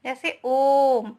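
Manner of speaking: ओम stretched long and slow, the vowel held; drawn-out om. Shown as a demonstration. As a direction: hold the vowel of ओम slowly. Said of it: "स्वर कहते हैं". (ओम को धीरे खींचकर बोलना)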